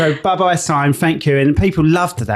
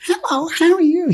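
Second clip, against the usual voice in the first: put-on much higher, perky American accent; American fairy voice. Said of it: "She was all American".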